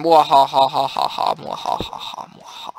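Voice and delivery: fading mwahaha villain cackle; evil laugh. Acted. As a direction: cackle like a villain, dying away. Villain laugh while playing.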